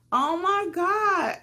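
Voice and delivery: drawn out in disbelief; stunned. Real, not acted.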